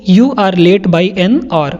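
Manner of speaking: slow, careful read with hour said as "or"; silent h in hour. Shown as a demonstration. Teacher modelling the sentence slowly for students with a silent h. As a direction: drop the H in hour and say it "or". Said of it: "एच silent होता है".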